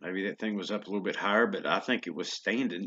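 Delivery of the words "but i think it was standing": ordinary talk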